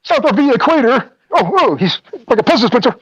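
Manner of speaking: very high, loud frantic announcer; Mauro Ranallo impression. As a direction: scream it like a wild commentator. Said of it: "remember how Mauro Ranallo used to be".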